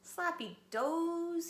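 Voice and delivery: howling complaint. Acted. Little wolf howling at the dinner table. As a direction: howl it out in protest. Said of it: "he howled".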